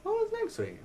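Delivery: comic falsetto; silly squeaky question. Pretend. Repeated question in high voice.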